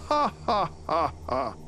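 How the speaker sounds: short taunting ha-ha, falling off; mild mock cackle; spirit's mocking laugh. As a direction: laugh down at the trapped hero. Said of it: "and laughed at him".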